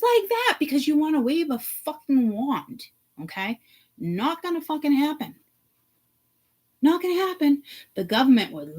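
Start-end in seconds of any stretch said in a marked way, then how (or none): none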